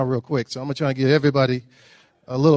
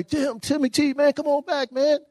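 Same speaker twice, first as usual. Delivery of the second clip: higher, mock calling from the beach; friends calling out; 9 semitones higher, 4 dB quieter than usual. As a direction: imitate buddies yelling him back in, pitched up. Friends calling him back from shore.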